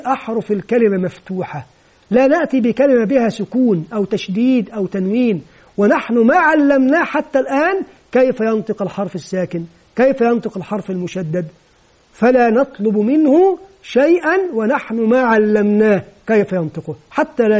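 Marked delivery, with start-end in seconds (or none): none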